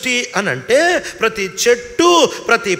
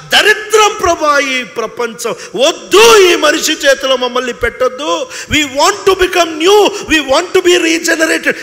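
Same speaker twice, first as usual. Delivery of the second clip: pleading creation; 5 semitones higher, 5 dB louder, slower than usual. Retold or imitated. Creation's plea voiced.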